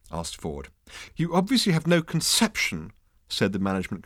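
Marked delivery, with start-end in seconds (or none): 1.2-2.9 high-pitched, haughty put-down; hauteur. A narrator's reading. Consultant abandons testiness, haughty. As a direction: look down on him as you say it. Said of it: "good old-fashioned hauteur".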